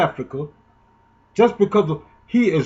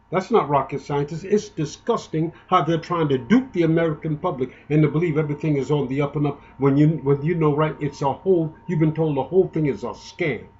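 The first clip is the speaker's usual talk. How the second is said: ordinary talk, nothing marked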